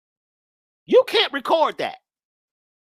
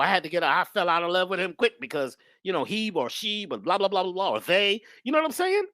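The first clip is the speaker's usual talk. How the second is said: ordinary delivery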